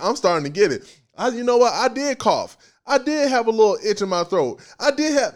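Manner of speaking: doubtful excuses. Shown as a demonstration. Preacher voicing the doubting soul's excuses. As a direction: talk yourself out of believing it. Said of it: "you see the tone even changes".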